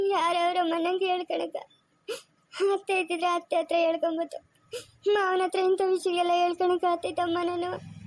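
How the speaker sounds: tearful, sobbing complaint; crying. Acted. Savitri weeps to her mother about her hard life, sobbing. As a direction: cry through the words of her fate. Woman pours out her sorrows.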